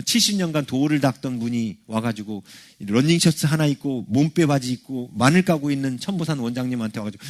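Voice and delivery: ordinary talk, nothing marked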